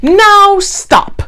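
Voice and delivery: high sharp order; commanding. A narrator's reading. Max ordering the wild things, firm. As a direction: stop them in their tracks. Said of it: "Max said".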